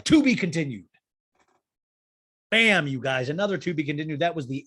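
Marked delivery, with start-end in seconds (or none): 0.0-0.9 loud opening, then falling away; mock cliffhanger announcer. Recapper closing the episode on a cliffhanger, punchy mock announcement.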